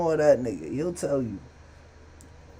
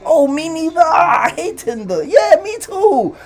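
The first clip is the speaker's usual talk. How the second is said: high, loud gushing back-and-forth; hammed-up delight, climbing higher and louder; mock excited agreement; 7 semitones higher, 9 dB louder than usual. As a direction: act out two people thrilled to agree. Acting out two delighted Tinder haters matching.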